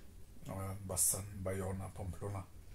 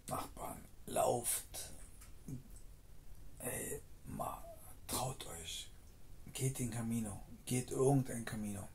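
normal talking voice

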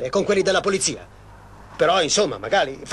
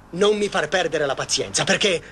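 usual speaking voice

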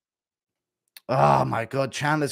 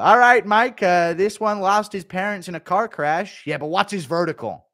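high, loud scout voice; a talent scout reading stats; Chandler at adoption agency; 6 semitones higher, 6 dB louder than usual. Acted. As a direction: size up kids like draft picks. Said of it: "Impression of Chandler at the adoption agency".

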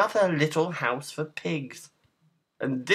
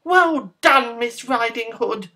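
high, loud piggy cheer; pig yelling; 9 semitones higher, 8 dB louder than usual. A narrator's reading. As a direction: yell praise in a high pig voice, easing at the end. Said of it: "stood and yelled".

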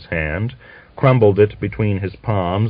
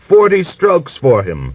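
raised, louder call for punishment; stern demand; 7 semitones higher, 5 dB louder than usual. Acted. Elder soldier demanding punishment, raised voice. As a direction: bark out the sentence.

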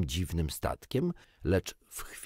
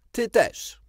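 much higher, louder retort; sharp reply; 7 dB louder than usual. A narrator's reading. Peter snapping back at the bird, high and loud. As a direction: fire it straight back. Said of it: "odpowiedział ostro".

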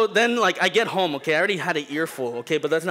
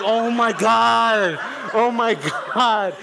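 slow, loud, laughing oh my God; laughing disbelief; 7 semitones higher, 8 dB louder, much slower than usual. Retold or imitated. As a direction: groan through laughter. Realizing dad forgot.

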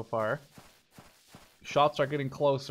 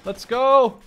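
loud, high cheer to charge; hyped rally cry; 9 semitones higher, 10 dB louder than usual. Real, not acted. Gamer rallying troops to charge with seconds left, hyped shout. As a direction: pump up the squad and go.

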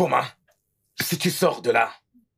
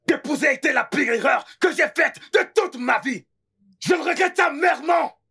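bitter shouting regret; 7 semitones higher than usual. Acted. Husband shouting his regret at his wife Naomi, furious.

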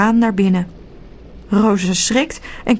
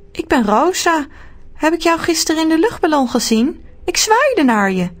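put-on higher girl's voice; girl Rosa; 7 semitones higher than usual. A narrator's reading. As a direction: ask brightly as a young girl. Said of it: "zegt Rosa".

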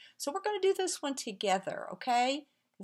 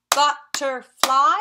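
"butterfly" in three loud beats, rising at the end; "butterfly" split in three, last beat lifting; syllable by syllable; 2 semitones higher, 10 dB louder, slower than usual. Shown as a demonstration. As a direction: clap out each syllable of "butterfly". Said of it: "as we say them, let's clap".

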